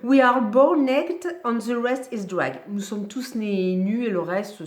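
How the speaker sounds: slow, loud French attempt at an English accent; announced English-accent showpiece, slow and emphatic. Shown as a demonstration. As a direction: put on a deliberate English accent, drawn out. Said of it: "Séquence accent anglais".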